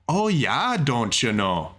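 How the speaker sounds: put-on Minnesota accent, drawn-out "Oh, yeah"; playful Minnesota accent quote. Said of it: "do you ever say".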